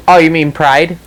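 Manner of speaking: usual speaking voice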